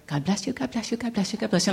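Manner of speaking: soft repeated murmur under the breath; muttered blessing. Retold or imitated. Walking to work, blessing her boss, muttered quietly. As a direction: murmur it to yourself like a private mantra.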